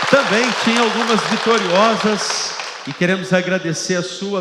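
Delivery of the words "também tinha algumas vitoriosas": loud gung-ho soldier. Real, not acted. Calls for applause after clip.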